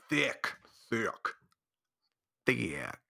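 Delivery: low sultry drawn-out voice; sexy voice. Pretend. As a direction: purr it seductively. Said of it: "That sounds sexy".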